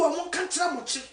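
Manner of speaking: usual speaking voice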